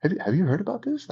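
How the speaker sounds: insider hush. Shown as a demonstration. Agent teasing a secret to a friend, soft and rising. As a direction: tease a secret. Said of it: "I would talk like that".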